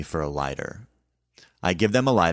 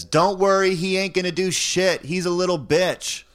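mocking woman; 13 semitones higher, 4 dB louder than usual. Woman taunting the man.

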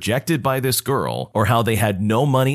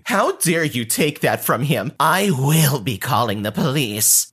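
mock screaming Karen; 4 semitones higher than usual. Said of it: "starts screaming at me".

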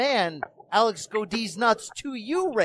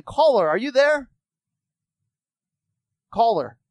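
calling out; 3 semitones higher, 4 dB louder than usual. Real, not acted. Calling out to phone caller.

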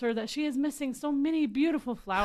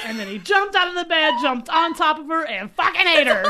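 higher, louder climax telling; loud excited narration; 5 semitones higher, 11 dB louder than usual. Acted. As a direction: build to the big finish. Dramatic climax of the story.

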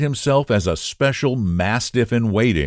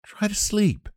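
put-on high, soft young woman's voice; young Sue, soothing; 13 semitones higher, 4 dB quieter than usual. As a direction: coax her to rest, pitched up. Sue's voice in the story.

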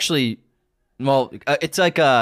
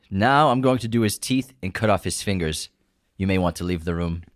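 put-on low-key Russian-accented movie quote; 5 semitones lower than usual. Host quotes Eastern Promises in quiz, Russian accent. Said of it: "This is a pretty good Russian accent".

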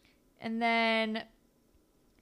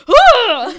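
very loud, high, hammed-up shocked yell; exaggerated yell; 12 semitones higher, 20 dB louder than usual. Impersonating Moose seeing the photo, over-the-top laughing yell. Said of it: "it's very exaggerated".

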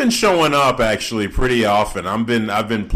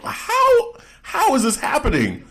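disbelief. Retold or imitated. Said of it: "The way I'm like".

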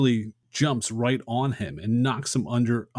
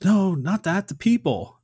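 higher, louder cry of protest, acted out; Superman's yell; 10 semitones higher, 5 dB louder than usual. Superman begging the villains to spare the people, retold yell. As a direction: yell it as a hero pleading to stop. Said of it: "Superman yells".

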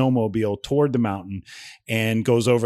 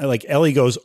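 ordinary delivery

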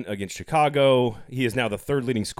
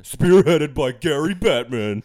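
slow, louder mock ranting voice; mock conspiracy voice; 3 semitones higher, 7 dB louder, slower than usual. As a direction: join in the paranoid rant. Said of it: "in that voice".

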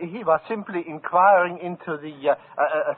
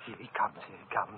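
quick, soft warning under the breath; hushed urgent warning; 4 semitones lower, 13 dB quieter, faster than usual. Acted. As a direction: hurry the warning out quietly. Warning as the maestro approaches.

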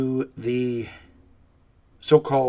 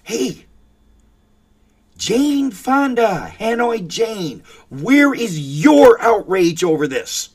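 slow, drawn-out call, then a much louder demand; indignant call-out; 8 semitones higher, 9 dB louder, slower than usual. Real, not acted. Host loudly demanding Greta's outrage, slow indignant shout. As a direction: bellow the question at her, stretching it out. Calling out Jane Fonda.

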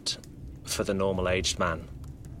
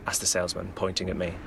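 usual speaking voice

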